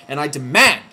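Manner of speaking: sudden loud demand; heated outburst. Acted. Ranting vlogger demanding an apology, loud and heated. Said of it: "my heated outburst".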